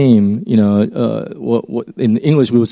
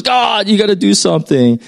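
desperate prayer; 8 semitones higher than usual. Retold or imitated. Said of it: "We believe that we're the last resource".

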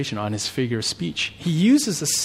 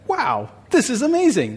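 much higher excited wow; mock amazement; 11 semitones higher, 4 dB louder than usual. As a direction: play the amazed onlooker. Hypothetical amazed reaction.

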